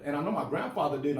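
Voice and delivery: usual speaking voice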